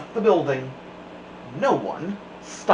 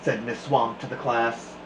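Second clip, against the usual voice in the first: usual speaking voice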